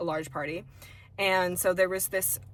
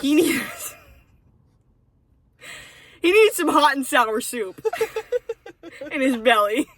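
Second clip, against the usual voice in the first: words broken by real laughter, higher and louder; laughing; 7 semitones higher, 8 dB louder than usual. Real, not acted. Cracking up at her co-host's joke, laughing through it.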